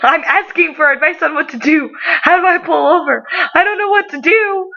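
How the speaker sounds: high, hammed-up panicked plea; retold panic. Employee begging the boss for advice, mock panic. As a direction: plead frantically, pitch climbing as you go. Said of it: "I'm panicking".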